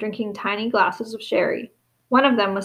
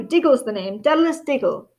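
eager little man; 2 semitones higher than usual. A narrator's reading. Diggle introducing himself.

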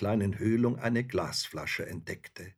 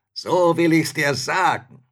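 loud, raised menacing spirit; spirit calling out; 7 semitones higher, 11 dB louder than usual. A narrator's reading. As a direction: announce it forcefully as a huge spirit. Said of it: "rief der Geist".

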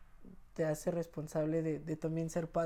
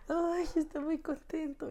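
much higher, slow gushing joy; tearful delight, pitched way up; overjoyed, near tears; 11 semitones higher than usual. Retold or imitated. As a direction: let the happiness spill over slowly. Overjoyed new father, tearful.

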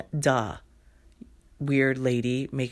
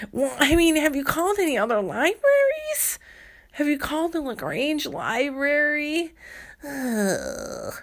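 shrill deflecting librarian; 11 semitones higher than usual. Acted. The librarian pushes the caller off to other libraries, very high. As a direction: pass the buck in a high squeal. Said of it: "librarian deflecting caller".

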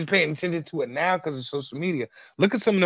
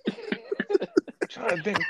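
high, slow, laughing through words; 4 dB quieter, much slower than usual. Real, not acted. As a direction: giggle the words out. Laughing while thinking aloud.

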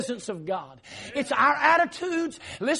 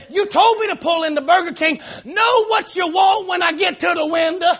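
retold angry outburst, louder and higher; 6 semitones higher, 6 dB louder than usual. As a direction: holler it in mock exasperation. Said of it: "I'm getting angry".

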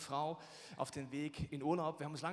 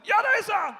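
loud, high shouted answer; wife shouting; 16 dB louder than usual. Retold or imitated. Wife handing her deaf husband the pepper, shouting. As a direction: yell it so the deaf one hears. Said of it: "„Schatz, gib mir den Pfeffer".

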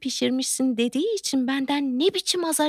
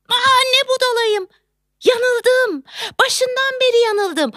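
high-pitched cry of self-reproach; sudden realisation; 11 semitones higher, 3 dB louder, slower than usual. Acted. Woman realising she was wrong all along, exclaiming. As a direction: exclaim high as it dawns on you.

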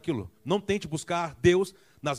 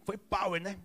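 higher, softer, offhand chatter; casual angels; 6 semitones higher, 5 dB quieter, slower than usual. Retold or imitated. Preacher joking as angels at the tomb, softer offhand chatter. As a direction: play the angels chatting lazily. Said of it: "Começando assim".

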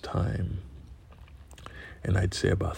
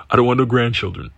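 mom's retort; 3 semitones higher, 8 dB louder than usual. Retold or imitated.

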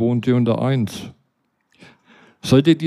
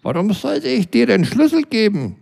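much higher, snippy retort; 9 semitones higher than usual. Acted. Neighbour Britta refuses the narrator the key, snippy and high. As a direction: toss the question back, cheeky. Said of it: "meinte sie schlipp-, schnippis".

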